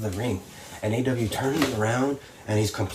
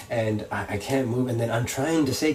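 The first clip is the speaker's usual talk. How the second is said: ordinary talk, nothing marked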